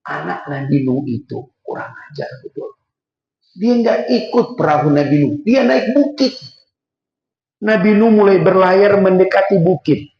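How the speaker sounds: ordinary talk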